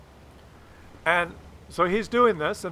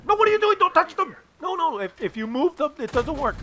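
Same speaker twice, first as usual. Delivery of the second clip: much higher urgent don't touch them; dad snapping to stop the fiddling; alarmed dad scolding; 10 semitones higher than usual. Retold or imitated.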